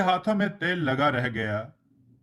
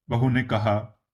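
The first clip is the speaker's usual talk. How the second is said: ordinary delivery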